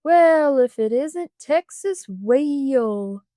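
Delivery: loud, slow smirking taunt; a mocking drawn-out greeting. A narrator's reading.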